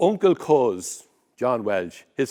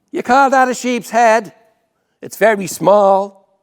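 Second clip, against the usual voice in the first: loud, high outraged complaint; indignant Dublin woman; 8 semitones higher, 9 dB louder than usual. Acted. Sheriff Street woman scolding the butcher, loud and high indignant. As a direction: scoff at the butcher's small sheep's head.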